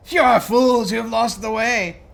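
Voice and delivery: high, loud furious monster yell; angry creature shout. Acted. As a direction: spit the insult at them. Third face spits, scolding.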